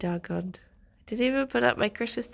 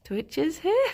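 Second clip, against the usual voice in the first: laughing disbelief; 7 semitones higher than usual. Retold or imitated. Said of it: "for a second I was just like".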